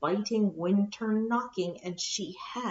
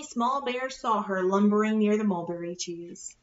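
ordinary delivery, nothing marked

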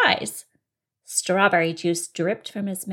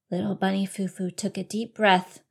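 ordinary delivery

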